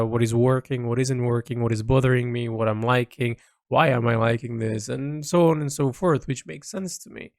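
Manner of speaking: ordinary delivery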